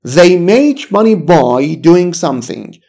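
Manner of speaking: very slow, careful English; slow model reading. Shown as a demonstration. Teacher drilling the pattern, very slowly. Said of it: "من با انجام فلان کار".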